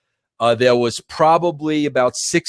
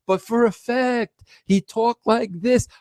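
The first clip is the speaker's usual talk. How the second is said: imitating the ring announcer; 9 semitones higher than usual.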